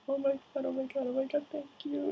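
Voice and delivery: tiny, very high, soft falsetto; imitated overwhelmed fangirl. Fan girl thanking the Doctor after a hug, squeaky awe. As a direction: mimic a starstruck squeak.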